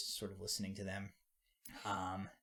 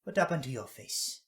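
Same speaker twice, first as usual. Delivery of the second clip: put-on higher, louder old woman, worried; concerned granny; 4 semitones higher, 5 dB louder than usual. Granny questioning a hurt boy, higher and worried. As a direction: press him gently but firmly about his face.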